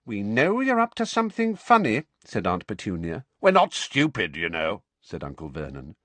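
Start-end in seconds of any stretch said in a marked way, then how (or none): 0.0-2.0 much higher, shrill accusation; accusing Aunt Petunia. A narrator's reading. 3.4-4.8 Uncle Vernon voice. A narrator's reading. Vernon indignant.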